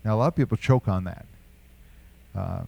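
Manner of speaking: ordinary talk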